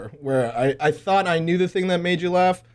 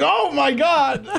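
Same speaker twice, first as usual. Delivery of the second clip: slow, high startled cry; drawn-out shocked oh my God; retold startled yell; 10 semitones higher, much slower than usual. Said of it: "I was like".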